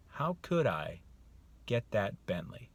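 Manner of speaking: thoughtful, with a pause after "I"; open wondering. Shown as a demonstration. Man mulling over the offered Bentley, wondering. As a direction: mull the question over. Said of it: "You hear the differences?".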